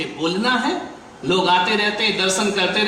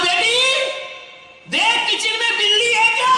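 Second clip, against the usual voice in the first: high, raised call toward the kitchen; 14 semitones higher than usual. Acted. Mother-in-law calling her daughter about kitchen noise, high raised call. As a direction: call across the house, suspicious.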